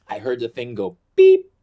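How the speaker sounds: ordinary delivery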